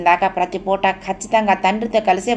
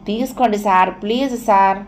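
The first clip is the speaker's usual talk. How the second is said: plain reading voice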